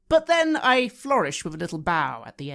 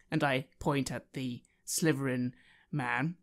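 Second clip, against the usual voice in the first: ordinary talk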